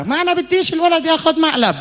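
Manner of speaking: high, indignant retort; shrill wife. Acted. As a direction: snap back sharply at husband. Wife snaps back.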